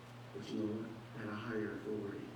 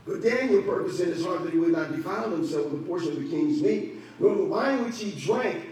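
full-force pulpit preaching; 9 semitones higher, 9 dB louder than usual. Acted. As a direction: preach it out with fire.

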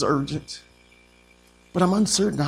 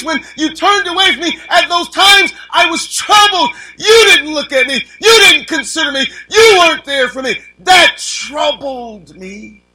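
high and loud, near crying on the complaints; preaching at full force; 12 semitones higher, 10 dB louder than usual. Acted. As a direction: wail David's hurt to the Lord. Preacher voices David's plea.

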